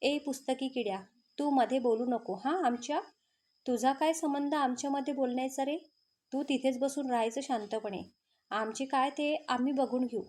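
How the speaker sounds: ordinary delivery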